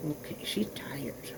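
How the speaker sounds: slow hushed whisper. Real, not acted. Owner whispering about her sleepy poodle to viewers. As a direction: whisper so the tired dog can rest.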